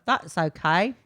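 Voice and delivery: drawn-out Australian vowels; Australian accent. Pretend. Co-host mimicking the Australian lady, slow Australian accent.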